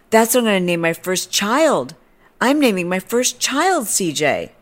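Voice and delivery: high, thrilled mock declaration; mock gushing. Stranger claiming the name for her child, high gush. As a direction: imitate someone overjoyed by a name.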